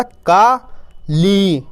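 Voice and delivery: "का", pause, "ली", each held long; two drawn-out, separate syllables, "का ... ली". Shown as a demonstration. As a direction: sound out "का" and "ली" separately. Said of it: "तो मिलके बन जाएगा".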